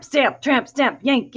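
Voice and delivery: plain reading voice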